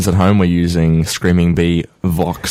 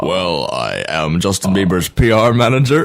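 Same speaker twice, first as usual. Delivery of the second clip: slow, halting presidential voice; Obama impression. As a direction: imitate a president, measured and drawn out. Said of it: "Obama being there and be like".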